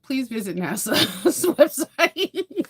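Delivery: slow, laughing through the words; laughing plug for the website. Real, not acted. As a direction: giggle out the recommendation.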